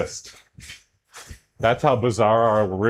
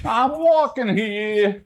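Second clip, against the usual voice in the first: higher, slow New York "I'm walking here" with drawn-out "walking"; New York accent; 8 semitones higher, slower than usual. Shown as a demonstration. Streamer showing the classic New York accent phrase. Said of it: "how do I get this to sound exactly like the".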